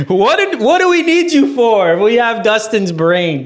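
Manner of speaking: high, mocking voice of the crew; mock crew complaint. Cast member mocking the others' usefulness, high and scornful. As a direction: pitch it up, scoffing at the others. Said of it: "It's like".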